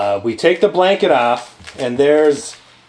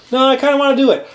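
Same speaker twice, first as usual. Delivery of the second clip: higher, lightly mimicked stubborn partner; Bob insisting; 7 semitones higher, much faster than usual. Retelling Bob's side of the argument, insisting. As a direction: play a mildly stubborn colleague, pitched up.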